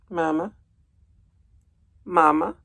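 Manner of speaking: high questioning little Mama; a lost baby goose asking for its mother; gosling voice. Acted. Reader voicing goslings trailing Bruce home, high and questioning.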